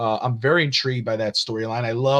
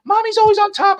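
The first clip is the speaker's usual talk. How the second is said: put-on high, louder kid voice, proud; daughter's voice; 8 dB louder than usual. Daughter defending Rhea, imitated in a high, loud kid voice. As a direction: chirp it like a proud little fan. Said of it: "You know, she'll".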